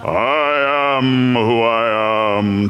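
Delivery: slow, grand booming announcement; Orson Welles voice. Acted. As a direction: intone it like a movie god. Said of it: "in his best Orson Welles voice".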